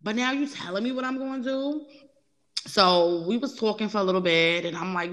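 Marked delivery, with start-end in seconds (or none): 0.0-1.9 higher, laughing mock indignation; a hint of a scoffing clapback, pitched up; retold New York sass. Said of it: "the New York coming out".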